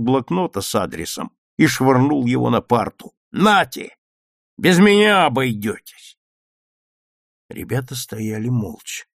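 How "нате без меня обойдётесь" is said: loud, higher parting shot; defiant outburst. A narrator's reading. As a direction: throw the words at them loudly. Petya flings down the paper and leaves. (с вызовом, громко)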